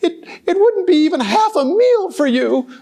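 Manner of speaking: high, pleading little goat voice, nearly crying; little billy goat. Acted. Little goat begging the troll not to eat him, high and tearful.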